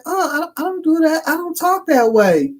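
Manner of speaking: imitating a woman's high Southern accent; high-pitched mock Southern refusal. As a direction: put on a high Southern voice, drawing out the end. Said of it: "she had that Southern accent".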